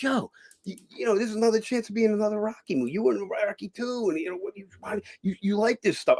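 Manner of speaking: imitating Gabe DeMarco. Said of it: "he's like".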